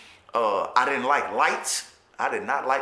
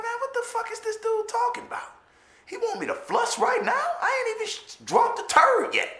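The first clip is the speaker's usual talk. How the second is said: mock outrage. New inmate, baffled that cellie demands an instant flush. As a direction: play the baffled kid, squeaking in disbelief. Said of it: "I'm like".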